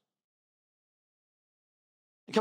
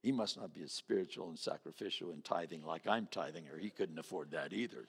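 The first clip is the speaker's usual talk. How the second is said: self-righteous mutter; 11 semitones lower, 8 dB quieter than usual. Retold or imitated. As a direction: lower and softer, smug and judging. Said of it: "you go".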